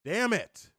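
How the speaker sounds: ordinary talk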